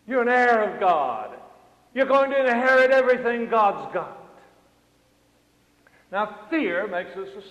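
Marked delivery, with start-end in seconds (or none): 0.1-4.4 loud, raised pulpit proclamation; ringing preacher's declaration; forceful preaching. Acted. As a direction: proclaim it boldly to the congregation.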